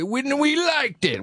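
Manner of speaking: put-on high, drawn-out old man character; Dana Carvey. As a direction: stretch it out like a comic old-timer. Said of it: "when he did the old man thing".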